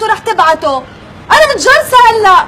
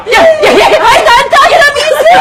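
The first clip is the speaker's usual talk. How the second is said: surprised shout; 6 semitones higher, 4 dB louder than usual. Acted. Surprised to see him arrive.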